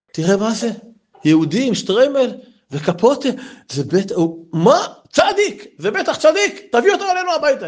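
high, eager woman's voice; voicing an excited townswoman; excited wife. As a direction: gush over the holy guest. Wife excitedly spots a tzaddik.

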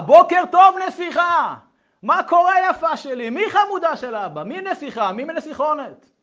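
put-on loud, high, gushing baby talk; shouted endearments. Shown as a demonstration. Father wakes his little princess, shouting lovingly. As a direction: yell sweet nothings at full cheer. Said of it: "אתם הולכים לצעוק".

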